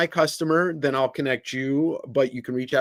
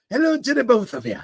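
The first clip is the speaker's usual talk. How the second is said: a sloppy attempt at a Scottish accent, pitched higher; bad Scottish accent; 10 semitones higher than usual. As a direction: throw in a quick, hammy Scottish accent greeting. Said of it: "I'll never do a Scottish accent again".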